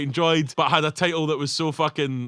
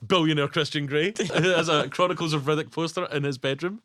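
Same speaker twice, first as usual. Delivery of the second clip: ordinary talk, nothing marked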